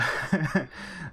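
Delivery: a quick high giggle bursting out; short giggle. Real, not acted. Vlogger teasing viewers about the romance, with a quick high giggle. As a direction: laugh it off lightly, a little embarrassed. Laughs at the show's romance hint.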